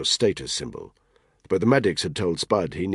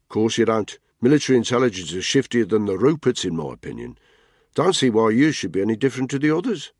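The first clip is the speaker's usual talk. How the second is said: higher, louder bloke ribbing a mate; Tony's mocking voice; 6 semitones higher, 4 dB louder than usual. Tony teases Spud about his bike, mocking and raised. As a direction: needle him with a grin.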